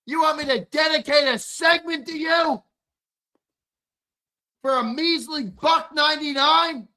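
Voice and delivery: hammed-up indignation at stingy viewers; mock outrage. Host ranting at chat about cheap super chats, hammed-up outraged shout.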